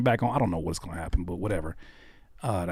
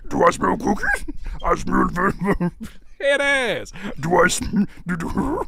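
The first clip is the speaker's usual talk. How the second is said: high, squeaky cartoon dog voice; 7 semitones higher than usual. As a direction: sniff around like a cartoon dog. Continuing the cartoon voice.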